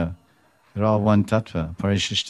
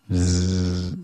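bee buzz. Retold or imitated. Imitating bee circling Radha.

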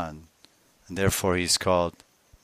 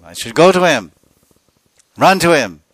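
much louder and higher, rushing to go; eager cry; 7 semitones higher, 15 dB louder than usual. Acted. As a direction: burst out with longing to run to him. Radha resolving to run.

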